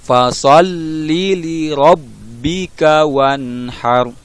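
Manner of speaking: Fasalli with lam pressed and held two beats; slow chant with doubled letters held; tasydid held. Shown as a demonstration. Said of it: "ditekan dan ditahan selama dua ketukan".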